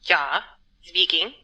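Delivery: put-on a doctor putting on a German accent to sound serious; mock German doctor voice, pitched low. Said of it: "I've been brushing up on my German accent".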